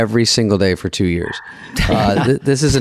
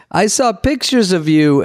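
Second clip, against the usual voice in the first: put-on German accent; 9 semitones higher, slower than usual. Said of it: "Is this your German accent?".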